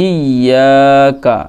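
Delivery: ইয়াকা stretched out very long, in a chanted recitation tone; slow recitation. Shown as a demonstration. Teacher models ইয়াকা slowly for students. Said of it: "teacher recites the joined word".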